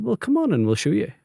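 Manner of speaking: put-on high-pitched, half-hearted Spanish accent; failed Spanish accent. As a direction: try a Spanish accent but land back home in Limavady. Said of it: "You can't do the Spanish accent".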